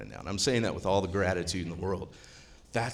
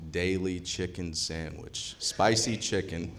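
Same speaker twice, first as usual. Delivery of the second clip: ordinary talk, nothing marked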